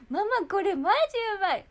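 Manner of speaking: high, loud excited outburst; papa-style rave. Retold or imitated. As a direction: gush to mom in rough, casual talk. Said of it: "そういう喋り方なパパのせいだって". (興奮した大声のくだけた口調)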